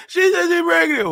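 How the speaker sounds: high, garbled mock crying; hysterical blubbering. Telling mother about the pregnancy, hysterical blubbering. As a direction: blubber the words out, hammed up. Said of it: "I was so hysterical".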